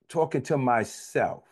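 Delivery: ordinary talk, nothing marked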